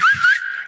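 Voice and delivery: shrill wolf-style signal whistle. Retold or imitated. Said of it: "I hear a whistle".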